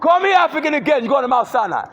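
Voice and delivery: high, loud yelling at a stranger; furious yelling at a stranger who cursed at her; furious shout. Real, not acted. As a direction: scream him down in the street. Yelling at passerby.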